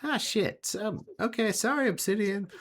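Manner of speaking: [name]'s voice. [name] apologizing after Obsidian dives through, high and sheepish. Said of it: "you just hear [name] on the other side goes".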